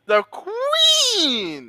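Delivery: soaring high call, Queen held long; drawn-out announcement. Acted. Co-host redoing his call to get it right, drawn-out high announcement. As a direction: sing out Queen and hang on it.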